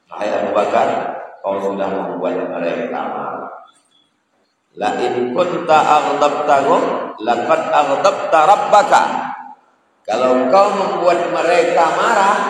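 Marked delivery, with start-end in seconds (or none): 4.8-12.4 higher, louder stern warning; forceful warning. Acted. Prophet warns Abu Bakar, preached loud and stern. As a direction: drive the warning home with force.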